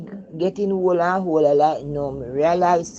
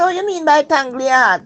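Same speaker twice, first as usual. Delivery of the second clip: high, loud puzzled question; young mother's startled question; retold young self asking; 10 semitones higher, 9 dB louder than usual. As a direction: ask it wide-eyed and baffled. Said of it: "So me say".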